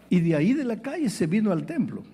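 normal talking voice

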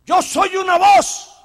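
slow, loud declaration; proud proclamation. Acted. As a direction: ring it out. [name] declares loudly.

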